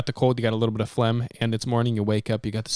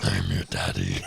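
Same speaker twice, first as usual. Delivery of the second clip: very low, soft mock-menacing reply; comically deep bass answer; deep daddy voice; 5 dB quieter than usual. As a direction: drop to the floor of the voice.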